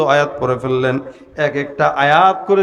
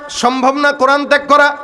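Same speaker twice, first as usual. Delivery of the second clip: high, forceful refusal; defiant call; 9 semitones higher than usual. Retold or imitated. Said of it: "ডাক দেয়া কয়".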